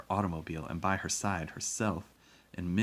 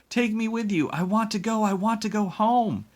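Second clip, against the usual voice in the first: shouting; 10 dB louder than usual. A narrator's reading. Boy begs to leave on the train, shouting. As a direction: scream it as a desperate child. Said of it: "he shouted".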